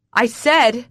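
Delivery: loud, forceful demand; growled insistence. A narrator's reading. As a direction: growl it as an order. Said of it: "he growls".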